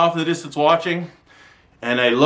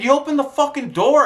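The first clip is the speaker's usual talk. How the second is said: angry accusation; 12 semitones higher than usual. Retold or imitated. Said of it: "I said".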